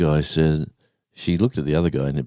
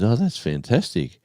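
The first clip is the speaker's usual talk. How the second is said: higher-pitched, pleased exclamation; retold delight; 6 semitones higher than usual. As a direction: play the coworker delighted at the offer. Retold delighted reply to offer.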